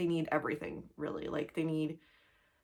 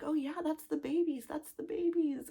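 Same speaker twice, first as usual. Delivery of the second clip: put-on child voice; 10 semitones higher than usual. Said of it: "now she's like".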